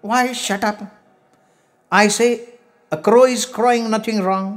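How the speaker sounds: high-pitched protesting voice; indignant crow-man. A narrator's reading. Man defending his crow calls, high indignant voice.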